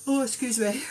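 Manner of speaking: yawning mid-sentence. Real, not acted. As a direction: talk through a yawn. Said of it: "I'm sorry I'm yawning".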